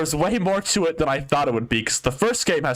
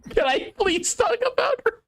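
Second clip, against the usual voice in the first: laughing plea; slower than usual. Real, not acted. Laugh-crying plea after coughing.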